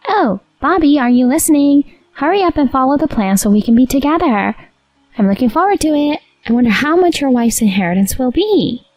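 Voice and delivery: mistress voice. Acted. Mistress on phone.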